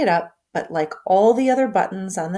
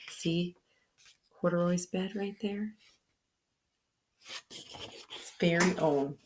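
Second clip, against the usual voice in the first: put-on reading to children; 11 dB quieter than usual. Aside to child listener.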